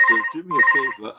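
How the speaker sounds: loud, exasperated plea; fed-up butcher loudly brushes off the stranger; exasperated outburst. Retold or imitated. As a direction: wave him off with a loud sigh of a line. Butcher scorns the rabbi.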